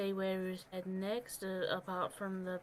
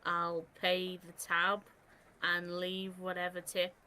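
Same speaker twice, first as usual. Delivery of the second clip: ordinary talk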